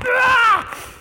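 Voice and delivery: loud, high-pitched mock outburst yell; losing it scream. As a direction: imitate someone finally snapping with a scream. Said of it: "and they finally lose it".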